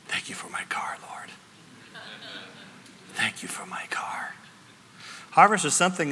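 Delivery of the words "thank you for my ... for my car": soft, unvoiced whisper of humbled gratitude; whispered thanks. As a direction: breathe it like a sheepish prayer. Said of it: "I said".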